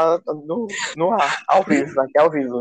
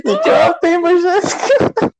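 high, loud laughing squeal; 5 dB louder than usual. Real, not acted. Host asking Jéssica for the book's theme, laughing hard. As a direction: squeal the question through real laughter. Host cracks up at rambling answer.

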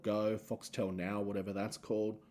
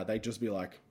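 ordinary delivery

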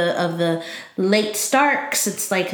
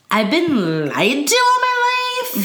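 mock wounded lament; 6 semitones higher, slower than usual. Jon's hurt reaction acted.

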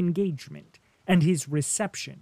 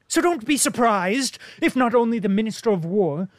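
higher, louder ironic lecture; a lightly voiced worldly diplomat, teasing; sardonic diplomat Bilbin; 6 semitones higher, 5 dB louder than usual. A narrator's reading. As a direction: needle the soldier with mock grandeur.

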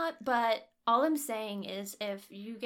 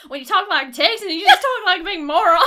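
loud, higher mock-angry accent; hammed-up angry rant cracking into laughter; imitated angry voice; 6 semitones higher, 13 dB louder than usual. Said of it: "like, a really angry voice".

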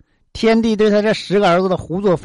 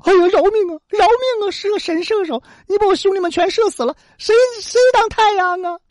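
begging for mercy; 12 semitones higher than usual. Acted. Last sun begging Houyi to spare him, high crying plea. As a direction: beg for your life, voice cracking. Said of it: "这个太阳大声求饶".

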